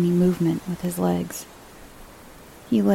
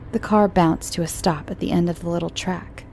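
ordinary delivery

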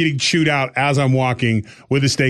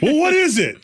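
much higher, sharp demand; wife demanding; 13 semitones higher than usual. Retold or imitated. Wife questioning her husband, high sharp demand. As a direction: press for an answer, high.

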